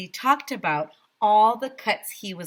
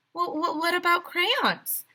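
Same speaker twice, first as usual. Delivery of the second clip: high, hesitant voice; timid teacher stammering; 11 semitones higher than usual. A narrator's reading. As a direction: ask nervously with a stammer. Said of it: "asked Miss Holly".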